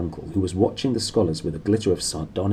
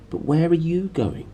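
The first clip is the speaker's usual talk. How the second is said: put-on higher young girl asking; eager girl; 9 semitones higher than usual. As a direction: ask like an anxious child. Lyra asks her uncle.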